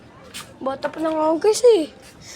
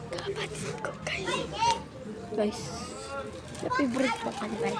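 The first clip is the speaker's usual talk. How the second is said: whispering; 16 dB quieter than usual. Real, not acted. Whispered aside to viewers.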